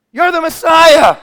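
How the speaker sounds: loud, high mocking shout; taunting shout. Thief on the cross shouting taunts. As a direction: jeer it up at him. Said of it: "he shouted".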